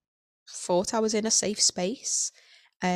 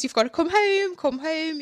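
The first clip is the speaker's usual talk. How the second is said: higher-pitched mock urging from family; imitating family; 8 semitones higher than usual. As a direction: lightly voice the family pleading to come home. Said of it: "I would obviously get told".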